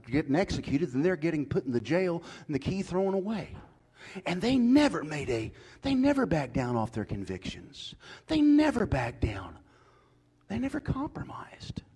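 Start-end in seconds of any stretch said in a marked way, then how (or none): 4.3-9.6 never hammered, rising and loud; fiery preacher pounding the word never; preaching at full force. Acted. Preacher building on repeated NEVER. 10.5-11.8 hushed, high whisper on never compromised; breathy pulpit whisper after the shouting; dramatic whisper. Acted. Sudden drop after shouted lines.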